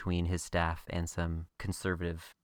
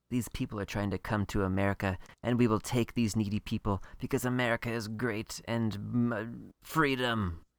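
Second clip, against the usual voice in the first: mock-preachy speech, pitched up towards the end; 3 semitones higher than usual. Podcaster parodying the episode's message on refugees, mock-preachy. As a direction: preach it as a hammed-up patriotic message. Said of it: "comes across as pretty preachy".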